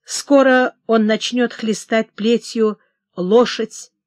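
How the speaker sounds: ordinary delivery, nothing marked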